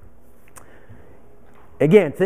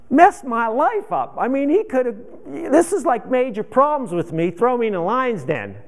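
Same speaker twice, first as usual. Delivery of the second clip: normal talking voice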